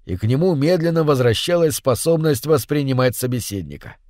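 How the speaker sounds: usual speaking voice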